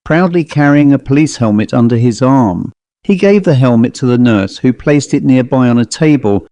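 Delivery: plain reading voice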